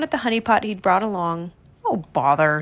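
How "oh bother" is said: low, muted grumble; Pooh bothered. A narrator's reading. Pooh looking into his honey pot, low mild dismay. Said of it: "he said".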